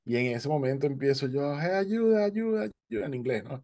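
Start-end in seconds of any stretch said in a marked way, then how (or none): 1.6-3.1 calling for help. Retold or imitated.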